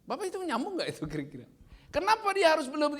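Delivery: usual speaking voice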